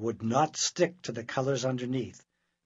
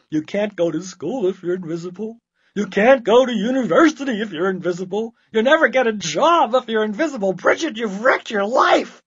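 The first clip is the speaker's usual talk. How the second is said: higher, loud building tirade; despairing rant; 8 semitones higher, 12 dB louder than usual. Acted. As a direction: scold in escalating panic. Mother rants in despair.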